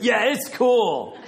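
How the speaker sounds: high, stretched-out overeager agreement; super excited. Retold or imitated. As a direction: imitate the convert's over-the-top delight. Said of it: "He was super excited, a little over the top".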